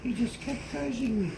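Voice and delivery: ordinary talk, nothing marked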